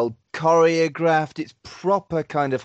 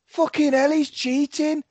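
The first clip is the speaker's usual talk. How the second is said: much higher outraged realisation, slowing on the last words; mock outrage; 13 semitones higher, 4 dB louder, slower than usual. Watching the film, outraged at the cheating villain. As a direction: squeal your shock at the cheat. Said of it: "I was like".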